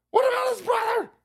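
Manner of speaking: high shout in a different voice. Acted. Repeated shouted.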